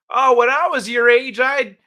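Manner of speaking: high, loud mock grandmother. Grandmother lecturing the young, high mock voice. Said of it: "a mother or a grandmother telling".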